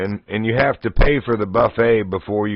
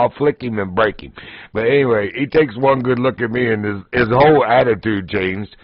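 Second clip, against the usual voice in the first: normal talking voice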